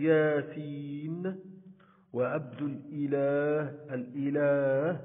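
ياسين and الإله with the long aa held; madd letter sounded. Shown as a demonstration. Teacher giving proper-name examples, long vowels in ياسين and الإله stretched. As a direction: draw out yaa in ياسين and laa in الإله, slowly. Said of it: "نلفظ حرف المد".

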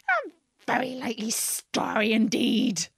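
scornful, put-on bird voice; contemptuous. A narrator's reading. Pigeon disbelieving Alice is a girl, contemptuous. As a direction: pour mild scorn on every word. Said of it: "in a tone of the deepest contempt".

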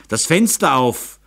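irritated count demanding action at once; impatient command. A narrator's reading. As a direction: order them about, out of patience. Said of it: "Graf Ecke wurde ungeduldig".

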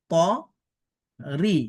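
ordinary talk, nothing marked

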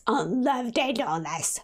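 hammed-up scandalised repeat; love day, said with mock outrage; mock indignation. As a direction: play the offended moralist, tongue in cheek.

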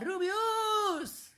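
very high drawn-out call; high, stretched call to a friend. Pretend. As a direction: sing the name out high without shouting. Calling out to his friend.

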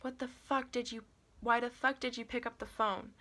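high-pitched, exasperated yell. A narrator's reading. Gunman scolds narrator for answering the phone, high yell. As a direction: yell it, breaking off and restarting.

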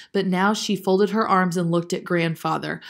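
plain reading voice

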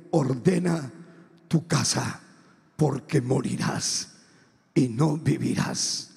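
low, slow, solemn pronouncement; voice of God. Retold or imitated. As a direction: deliver a sentence from God, measured and deep. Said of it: "Dios me dijo".

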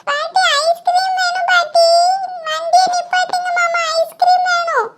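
put-on high-pitched, drawn-out child's begging; pleading child. Child begging grandmother for ice cream in the car, high and insistent. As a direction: beg slowly in a high little kid's voice.

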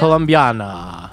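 Colombiana said slowly in Spanish, every syllable spelt out; Colombiana, ko-lom-bee-AH-na, drawn out; Spanish pronunciation. Shown as a demonstration. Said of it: "how do you say it?".

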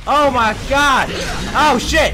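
high, loud, repeated cries; panicked yelling. Real, not acted. Player under attack begging for help, yelling in panic. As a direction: cry out for rescue in a game panic.

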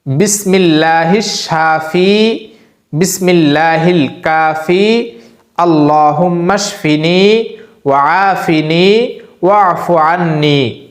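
slow, drawn-out Arabic prayer recitation; dua recitation. Shown as a demonstration. Imam teaching a healing dua, slow recitation. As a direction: recite each phrase slowly so they can memorise it. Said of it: "আবারও বলছি".

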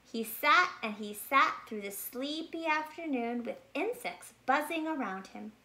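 plain reading voice

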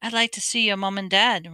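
usual speaking voice

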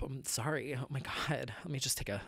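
breathless, hushed apology; catching breath after getting heated. Real, not acted. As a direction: pull back and breathe after the outburst. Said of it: "whew, sorry".

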